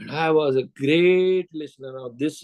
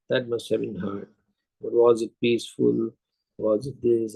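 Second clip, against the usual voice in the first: low, subdued condolence; depressed sympathy; 6 semitones lower than usual. Retold or imitated. Listener consoles a bereaved customer, low sympathetic voice. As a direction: lower the voice into gentle, sad concern. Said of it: "with a depressed face".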